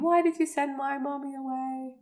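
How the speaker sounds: imitated little boy's drawn question; little ghost boy. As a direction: plead like a small boy, lightly acted. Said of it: "the little boy who's been scalped".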